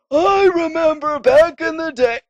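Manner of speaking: put-on high, loud wheezy old man. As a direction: croak it out like an ancient elder. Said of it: "I can do the wheezy old man voice".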